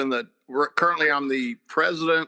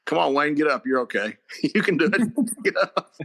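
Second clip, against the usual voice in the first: mock encouragement; 5 semitones higher than usual. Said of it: "I'm looking at him going".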